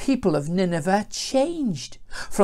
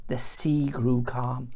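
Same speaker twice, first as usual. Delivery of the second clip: ordinary delivery, nothing marked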